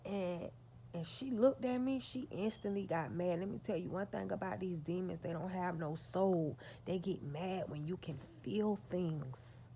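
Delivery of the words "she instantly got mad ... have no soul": ordinary talk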